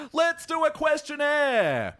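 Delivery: high, slow, drawn-out call; segment announcer. Pretend. Host launching the podcast questionnaire, theatrical announcement. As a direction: sing out the segment title theatrically.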